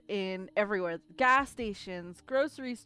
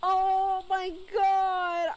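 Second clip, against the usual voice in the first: distressed wail; 7 semitones higher, 3 dB louder, much slower than usual. Real, not acted. Shocked discovery of sold items.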